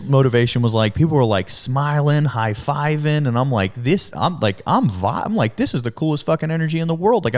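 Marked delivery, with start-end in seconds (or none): 5.6-7.3 thrilled. Retold or imitated. Himself on his first day on the sales floor, much higher excited voice. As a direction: marvel at the energy in the room.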